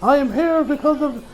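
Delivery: a hint of a proud gangster introducing himself; Bucciarati introducing himself.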